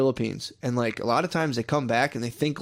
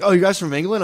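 eager tourist greeting; 7 semitones higher, 6 dB louder than usual. Retold or imitated. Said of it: "I was like".

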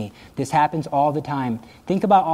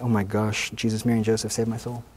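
mock exasperated prayer; 7 semitones lower, 6 dB quieter than usual. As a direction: lower and softer, mock-despairing aside. Mock exasperated plea to saints.

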